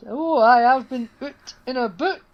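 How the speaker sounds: hammed-up attempt at a Scottish accent; slow, high, loud mock Scottish accent; Billie Piper. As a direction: do a bad Scottish accent, drawn out. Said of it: "tries to do a Scottish accent".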